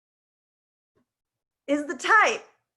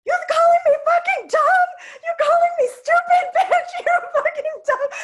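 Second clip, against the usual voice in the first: much higher and much louder, cursing at full shout; furious yelling brother; 12 dB louder than usual. Retold or imitated. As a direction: scream curses at the girl who hurt his sister. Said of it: "he's like".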